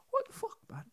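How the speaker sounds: mock English accent breaking through; English accent slipping. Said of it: "Idris would just be like".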